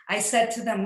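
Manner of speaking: normal talking voice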